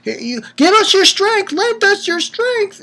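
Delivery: high-pitched pleading, stretched out slowly; pleading Amy. Retold or imitated. Amy begging Redo to lend his strength, high pleading. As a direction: beg him for help in a high voice. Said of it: "Amy comes running in like".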